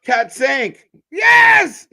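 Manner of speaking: very high, loud cheering cry; excited shout. Real, not acted. Watcher finishes the French count, shouting excitedly.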